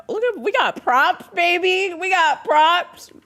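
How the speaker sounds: high, excited hype. Real, not acted. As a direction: show off the props, thrilled. Excited about having props.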